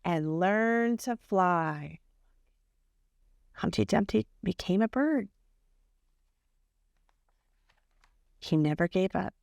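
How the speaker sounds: gentle storybook reading voice; reading to children. A narrator's reading. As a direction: tell it like a bedtime story to a class. Reads Humpty's story aloud.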